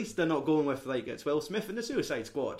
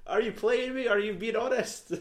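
high, loud comic pleading; mock exasperation; 9 semitones higher, 8 dB louder than usual. Viewer begging the film to stop conning him, exasperated. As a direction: plead loudly, pitch right up, half laughing.

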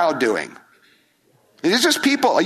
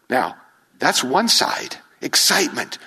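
ordinary talk, nothing marked

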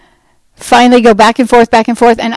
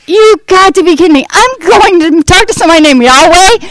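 incredulous disbelief; 9 semitones higher, 3 dB louder than usual. Retold or imitated. Disbelief at host's name Yahweh.

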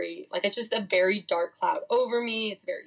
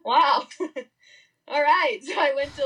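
laughing; 7 semitones higher, 4 dB louder than usual. Real, not acted. Laughing after her punchline.

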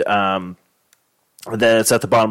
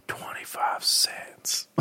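whisper; 12 dB quieter, much slower than usual. Pretend. Fake movie tagline.

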